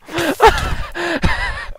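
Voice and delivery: squealing high laugh; high laughing fit. Real, not acted. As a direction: break into squeals.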